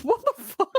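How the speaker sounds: high, disbelieving what the fuck; baffled outburst. Retold or imitated. Host retelling her reaction to the scene, mock shock.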